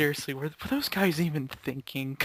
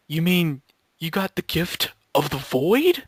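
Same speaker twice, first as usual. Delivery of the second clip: mock ominous; 3 semitones higher, 4 dB louder, slower than usual. Ominous joke voice.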